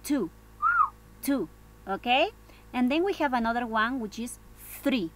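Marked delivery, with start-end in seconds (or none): 0.0-1.5 two with a puff of air on the t; aspirated t. Shown as a demonstration. Teacher modelling the number for students, aspirated two. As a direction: blow out the t in two. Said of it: "Entonces acá decimos".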